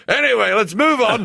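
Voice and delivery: a light booming staff-marshal voice, brushing it off; booming officer. Retold or imitated. Officer brushing off the widow, light booming voice. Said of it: "big booming staff marshal voice".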